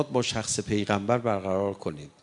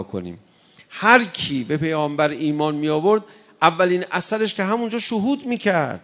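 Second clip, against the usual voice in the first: higher and louder, impassioned sermon climax; preaching at full force; 7 semitones higher, 4 dB louder than usual. Acted. Preacher rouses the congregation with impassioned, loud pleading. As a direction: build up the fervor toward a cry.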